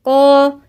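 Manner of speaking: isolated قا; heavy qaf. Shown as a demonstration. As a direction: demo of the heavy قا. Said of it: "القاف هنا مفخمة".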